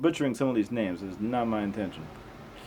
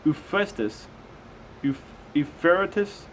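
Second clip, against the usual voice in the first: raised, slow Bible reading voice; slow, measured scripture reading; 4 semitones higher, slower than usual. Acted. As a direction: read it out like a careful lesson.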